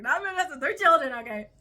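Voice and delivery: loud laughing correction; emphatic correction. Real, not acted. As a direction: set the record straight loudly.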